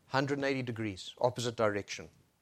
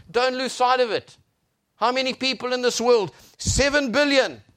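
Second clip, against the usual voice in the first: loud, higher preacher's delivery; impassioned preaching; 10 semitones higher, 10 dB louder than usual. Real, not acted. Preacher declaring God's word to the congregation, impassioned preaching. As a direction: proclaim it with full force.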